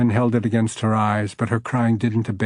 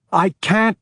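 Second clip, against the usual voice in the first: distressed; 9 semitones higher, 4 dB louder than usual. Acted. Woman pressed, voice breaking.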